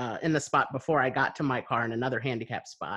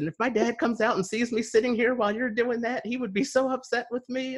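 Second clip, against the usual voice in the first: anxious stranger; 9 semitones higher than usual. Retold or imitated. Stranger fearing her dad.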